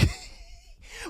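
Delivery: high shrieking laugh; screaming laugh. Real, not acted. Fan shrieks a laugh over the playoffs win, high. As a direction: shriek a laugh in pure joy.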